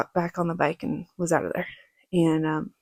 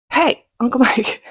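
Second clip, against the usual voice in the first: higher, louder call for attention; 5 semitones higher, 7 dB louder than usual. Retold or imitated. Niece calls to her uncle in the dark, calling out. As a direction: call out slowly to someone nearby.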